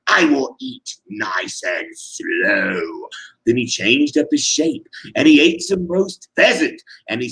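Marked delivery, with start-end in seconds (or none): 0.1-3.1 low, slow sneer; lower, drawn-out mocking sneer. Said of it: "he sneered".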